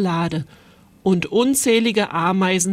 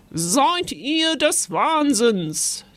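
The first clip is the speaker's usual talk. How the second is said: high, slow, drawn-out outrage; aghast scolding; 6 semitones higher, much slower than usual. A narrator's reading. Ghost mother finding ants in her bag, an aghast high scolding. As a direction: scold them in stunned disbelief. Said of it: "Die Gespensterfrau starrte fassungslos".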